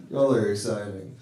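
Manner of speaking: ordinary talk, nothing marked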